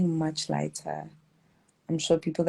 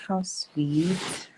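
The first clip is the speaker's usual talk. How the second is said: normal talking voice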